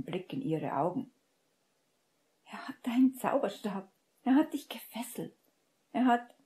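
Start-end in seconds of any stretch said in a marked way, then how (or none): 2.4-6.3 agitated protest. A narrator's reading.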